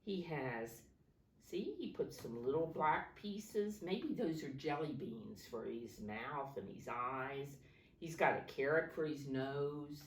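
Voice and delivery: usual speaking voice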